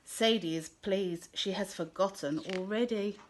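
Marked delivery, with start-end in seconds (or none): none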